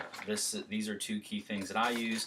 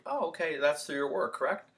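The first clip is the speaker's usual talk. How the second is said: friendly rebuttal; 7 semitones higher than usual. Shown as a demonstration. Salesman answering objection.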